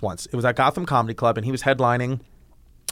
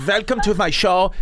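higher-pitched imitation German accent; 4 semitones higher than usual. Imitating a comedian coming out on stage, German accent. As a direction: greet the crowd in a German accent. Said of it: "full German accent".

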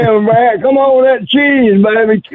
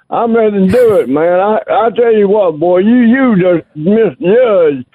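ordinary talk

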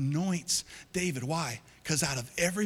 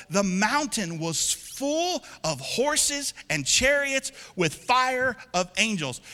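fervent scripture reading; 6 semitones higher, 5 dB louder, slower than usual. Real, not acted.

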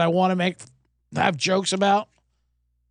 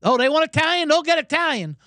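Italian bravado; 7 semitones higher than usual. Retold or imitated. Italian fans answering the mockers, in a shouted Italian brag. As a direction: boast like a proud Italian fan. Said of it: "they even said it".

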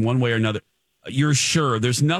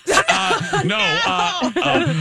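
goofy high sales-pitch voice; silly prank call voice; 8 semitones higher, slower than usual. Pretend. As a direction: ham up a prank caller selling Amway. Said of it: "I sounded like a prank caller there".

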